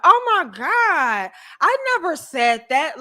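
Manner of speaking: high, drawn-out mock shock; mock offended woman. Woman denying she led him on, mock offended. Acts out gaslighting woman's denial.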